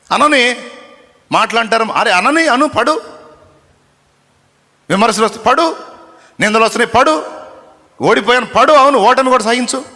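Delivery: high, loud, driving sermon cry; preaching at full force. Acted. Preacher urges the downcast congregation to endure, in a loud preaching cry. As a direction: hammer each command home to the crowd.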